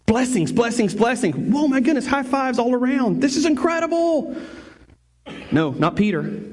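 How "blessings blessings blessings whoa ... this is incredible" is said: rising to a high, overjoyed cheer; hammed-up thrilled fisherman; mock excitement. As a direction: celebrate like you just hit the jackpot. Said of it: "here's what we would do".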